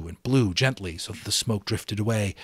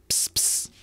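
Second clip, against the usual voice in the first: rubbing noise; 3 dB quieter than usual. A narrator's reading. Roger mimes rubbing sticks.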